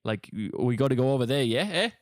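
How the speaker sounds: put-on South African accent. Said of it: "I never liked South African accent".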